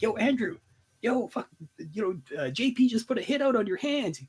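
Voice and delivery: high, breathless retold warning to a friend; urgent warning. Warning Andrew about the hit, high urgent voice. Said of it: "and I'm like".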